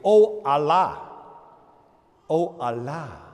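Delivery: imploring prayer. Retold or imitated. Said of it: "so they would say".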